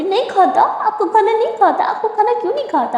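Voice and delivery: high, teasing echo of खाता; mocking repeat. Durga teasing Simran's slip of the tongue, mocking and high. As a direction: mimic her slip to tease her. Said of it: "गलत word निकल गया तो उसका मजाक बना रही हो".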